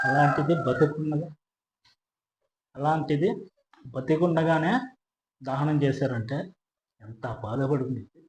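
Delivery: slow, hushed, sorrowful; halting and very soft, dwelling on the suffering; low-voiced grief with long pauses; pained pity. Real, not acted. Pitying a victim burnt alive.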